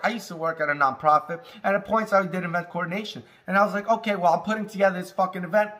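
ordinary talk

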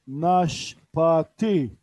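Naşpati drawn out very slowly, each syllable clear; slow model word. Shown as a demonstration. Teacher to online Urdu class: Naşpati drawn out very slowly. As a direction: stretch Naşpati slowly for learners. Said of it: "repeat slow model".

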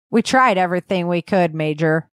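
plain reading voice

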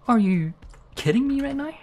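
sudden high-pitched disbelief. Real, not acted. Gamer short on feathers, high disbelieving squeak. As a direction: squeak out the outrage.

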